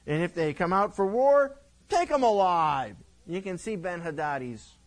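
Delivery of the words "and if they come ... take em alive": lightly voiced boastful king giving orders; arrogant drunk king. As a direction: bark it out without a care. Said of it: "And he said".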